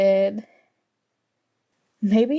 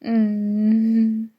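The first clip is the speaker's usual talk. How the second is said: ordinary talk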